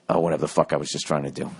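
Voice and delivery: ordinary delivery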